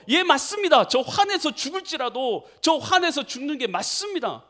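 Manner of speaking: high-pitched defiant retort; angry Jonah. Acted. Jonah insists to God he is right, angrily. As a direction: answer back hotly, stretching the words. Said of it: "화가 막 나는 거예요".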